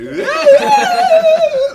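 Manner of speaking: very high, loud squealing vocal note shooting up; mock autotune wail. Pranked singer's autotuned voice, imitated as a high loud squeal. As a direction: imitate a voice flying off key into the gods.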